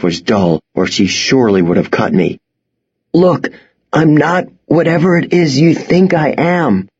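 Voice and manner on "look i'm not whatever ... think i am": strained croak under choking hand. Acted. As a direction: croak with no breath. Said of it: "I croaked".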